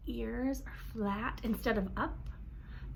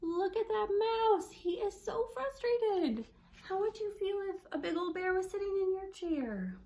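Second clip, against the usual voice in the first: put-on cueing kids; 9 semitones higher than usual. Shown as a demonstration. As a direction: coax the kids to notice. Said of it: "I'm gonna clue my kiddos".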